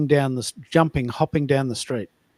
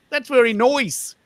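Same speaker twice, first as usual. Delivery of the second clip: high, slow, exaggerated Melbourne twang; stretched Melbourne vowels, pitched high; Melbourne accent; 9 semitones higher, 3 dB louder than usual. Shown as a demonstration. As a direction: ham up Melbourne, "nice" drawn out as "noise". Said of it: "Very Melbourne".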